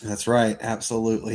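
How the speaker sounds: normal talking voice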